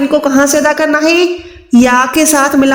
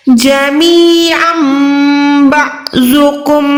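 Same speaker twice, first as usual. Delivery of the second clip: slow chant of जमीअम बअज़ुकुम; slow recitation; much slower than usual. Shown as a demonstration. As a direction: recite slowly with iqlab. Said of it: "आइन दो जबर के बाद". (धीमी तिलावत)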